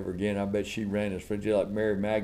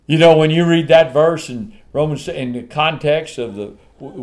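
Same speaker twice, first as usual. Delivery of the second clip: loud, high, urgent preacher; raised, forceful pulpit voice; full-force preaching; 6 semitones higher, 11 dB louder than usual. Real, not acted. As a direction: drive it home at the top of your voice.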